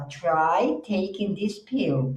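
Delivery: very slow classroom read; slow model sentence. Shown as a demonstration. Teacher reads an example sentence to the class. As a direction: stretch each word for learners. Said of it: "você fala".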